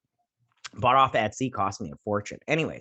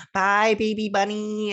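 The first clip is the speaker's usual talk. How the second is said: high, drawn-out mock baby talk; sugary goodbye; 9 semitones higher, 4 dB louder, slower than usual. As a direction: coo a goodbye to a little child. Voicing parent's sugary goodbye.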